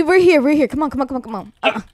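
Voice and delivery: ordinary talk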